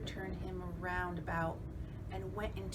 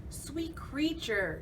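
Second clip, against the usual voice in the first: spider's voice; 7 semitones higher, 4 dB louder, slower than usual. Acted. Spider flatters.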